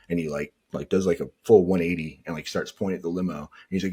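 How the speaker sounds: ordinary delivery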